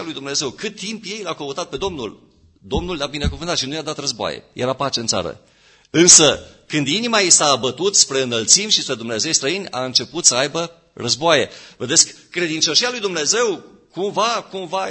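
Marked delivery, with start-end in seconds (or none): 5.9-14.9 raised, emphatic sermon voice; forceful preaching. Real, not acted. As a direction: press the warning home, high and loud. Preacher rises again in force. (predică apăsată, cu glas ridicat)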